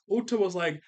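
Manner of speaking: ordinary talk, nothing marked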